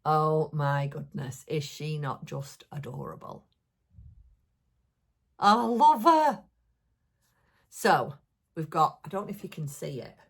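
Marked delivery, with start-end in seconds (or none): 5.4-6.4 higher, louder coo of delight; delighted gush. Real, not acted. Vlogger cooing over her new ballerina mouse, high and loud. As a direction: fall for the little figure out loud.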